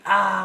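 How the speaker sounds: short high loud mock scream. Friend mimics his wife's labor scream, high and loud. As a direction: yell along in fun. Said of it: "when you're screaming".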